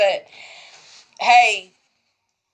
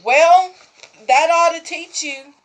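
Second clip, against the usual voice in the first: smug retort; 7 semitones higher, 4 dB louder than usual. Retold or imitated. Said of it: "I said".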